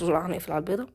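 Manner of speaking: ordinary talk, nothing marked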